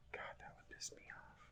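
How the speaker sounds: hushed, breathy aside under the breath; muttered annoyance in a whisper; whispered gripe. Real, not acted. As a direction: whisper it to yourself, irritated.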